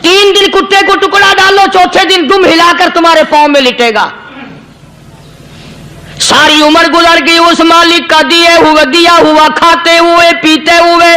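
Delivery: impassioned preacher; preaching. Acted. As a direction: drive the point home to the crowd.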